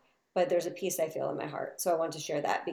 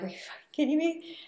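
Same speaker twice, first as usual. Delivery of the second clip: exasperated disbelief; 9 semitones higher than usual. Retold or imitated. Furniture delivery pushed back a month.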